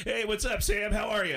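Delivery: high, loud hearty greeting; Tom Arnold. Acted. Celebrity on the phone greeting his supposed friend, high hearty impression. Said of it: "And Tom goes".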